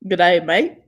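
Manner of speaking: put-on a quick Aussie greeting in Australian accent; shy attempt at an Australian accent. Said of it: "Say something in an Australian accent".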